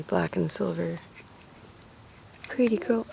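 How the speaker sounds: normal talking voice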